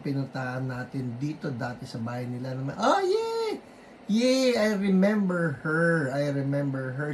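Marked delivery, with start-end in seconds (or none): 2.7-4.6 much higher, louder happy yay; delighted squeal. Real, not acted. Host spotting a friend in chat, delighted squeal. Recognizes a familiar viewer. 4.6-6.1 higher and louder, still thrilled; excited. Real, not acted. Host recognizing a viewer, excited gush.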